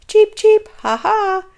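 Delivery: high, loud cheeping laughter; chirping laugh. Acted. Bird jeering at Shelley, chirping laughter.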